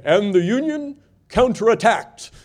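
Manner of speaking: 1950s narrator. Retold or imitated. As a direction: intone like an old newsreel voice. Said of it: "then the voice would say".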